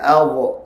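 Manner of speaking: "Allah" as adh with a bouncing release on the dha; faulty qalqalah. Shown as a demonstration. Teacher shows the common weakness, bounced ending on "Allah". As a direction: let the final sound of "Allah" pop into an extra short vowel. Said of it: "Selalu bunyi qalqalah".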